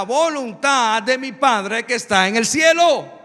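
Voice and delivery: loud, high, slow pulpit reading; scripture proclamation. Acted. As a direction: proclaim the Gospel passage, building to a forceful declaration. Reads scripture aloud to congregation.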